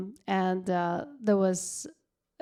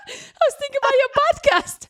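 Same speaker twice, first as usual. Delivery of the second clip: high loud mock girlfriend; 9 dB louder, faster than usual. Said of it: "And she's like".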